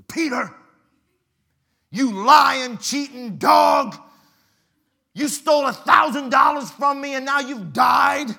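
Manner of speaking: high, loud angry yelling at the corpse; mock furious creditor; furious shout. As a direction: rage at the cheat. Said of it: "But he's mad".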